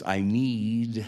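need stretched long and slow; dragged out need. Retold or imitated. Dying friend struggling to ask, need dragged out. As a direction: draw the word need out. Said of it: "he just drugged that last word, "need," out".

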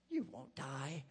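low, soft serpent line, hushed and sly; hissing serpent. Acted. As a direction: hush it low like a snake tempting her. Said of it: "the serpent hissed".

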